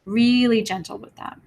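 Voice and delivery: ordinary talk, nothing marked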